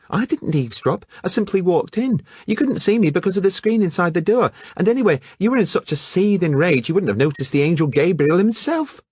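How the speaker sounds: put-on indignant young woman. Phyllida insisting she didn't eavesdrop, high indignant voice. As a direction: defend yourself in a huffy rush.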